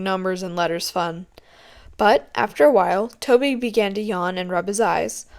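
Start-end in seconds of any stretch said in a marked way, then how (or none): none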